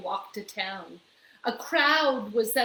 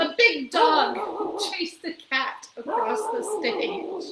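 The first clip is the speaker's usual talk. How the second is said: usual speaking voice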